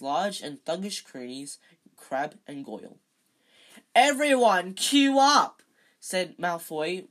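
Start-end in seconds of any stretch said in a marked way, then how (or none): 3.9-5.6 high, loud announcing shout; roaring. A narrator's reading. Bully summoning the courtyard crowd, roaring. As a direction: roar it to the crowd. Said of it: "roared to the crowd".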